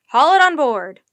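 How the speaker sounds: forceful high shout; roaring. A narrator's reading. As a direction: bellow a command to the crew. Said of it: "roared the professor".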